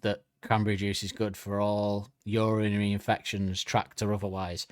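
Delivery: usual speaking voice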